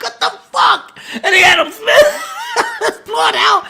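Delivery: can barely talk for laughing. Real, not acted. Laughing at character's death.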